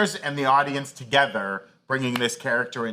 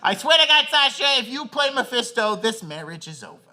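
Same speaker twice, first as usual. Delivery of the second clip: put-on very high, loud Isla accent, dropping at the end; hammed-up Isla accent, starting loud and high; Isla Fisher accent; 9 semitones higher, 3 dB louder than usual. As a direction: threaten your husband in a put-on Isla accent. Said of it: "this is my accent for Isla".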